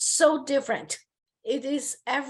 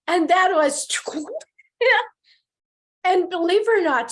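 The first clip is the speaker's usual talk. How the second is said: laughing through the words, louder and higher; 6 semitones higher, 6 dB louder than usual. Real, not acted. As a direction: burst into rueful laughter mid-sentence. Retelling the professor's discouraging remark.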